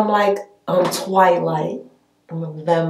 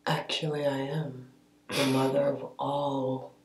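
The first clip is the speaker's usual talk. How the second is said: quiet regal vampire; 5 semitones lower, 11 dB quieter than usual. Acted. Vampire goddess, quiet grand claim.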